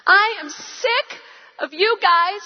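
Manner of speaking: a hint of a crying teenager, rising on "poor"; imitating an upset teen. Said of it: "he's crying".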